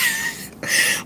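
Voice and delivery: high squeal laugh. Real, not acted. Laughs seeing dogs at window.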